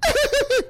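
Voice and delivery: a very high squeal of a laugh; imitated laugh. Host imitating the singer's laugh on her dub, squealed high. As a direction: mimic her giggle way up high. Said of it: "she laugh after them".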